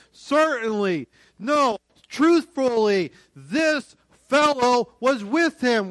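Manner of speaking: high, loud, deliberate insistence; emphatic accusation. Acted. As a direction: accuse him publicly, loud and certain. Acted accusation against Peter.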